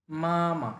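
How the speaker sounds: both মা held long and level; মামা with both syllables drawn out flat; Mandarin first tone. Shown as a demonstration. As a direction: stretch মামা on one flat pitch. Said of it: "first tone দুইটাই টেনে বলতে হবে".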